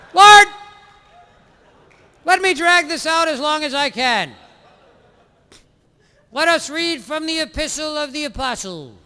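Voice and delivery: high, sing-song sermon voice; droning priest. Acted. Parody priest sermonizing to his flock, high and nasal-free drone. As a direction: drone on to fill the hour.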